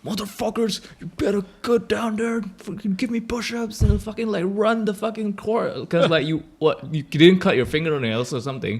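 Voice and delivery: mock drill-sergeant yell, pitched high and loud; imitating a raging teacher; angry teacher yelling. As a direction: bark orders like a furious teacher on orientation day. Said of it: "they'll act angrier than they usually are".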